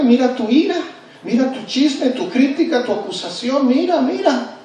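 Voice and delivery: jab at them like a sneering accuser; mocking accuser. Accusing conscience mocks the believer.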